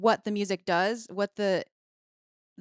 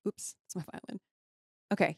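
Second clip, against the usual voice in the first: usual speaking voice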